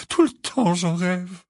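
high, plaintive tearful whimper; moaning. A narrator's reading. Missus Wesley confides her nightmares, moaning. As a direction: whimper it as if still crying. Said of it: "gémit Missus Wesley".

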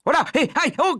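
quick burst of pained exclamations; yelping cries. A narrator's reading. Man hitting his own trouser leg, crying out. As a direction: yelp with each slap at the mouse. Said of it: "cria l'homme".

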